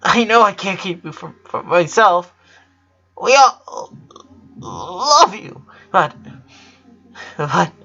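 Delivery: high, tearful, breaking voice; crying sister begging her brother not to leave; tearful plea. A narrator's reading. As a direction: hold back sobs while begging.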